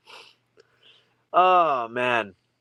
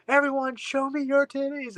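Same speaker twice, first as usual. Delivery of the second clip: rock-star call; 12 semitones higher than usual. Retold or imitated. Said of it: "he was like".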